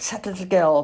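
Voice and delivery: ordinary delivery, nothing marked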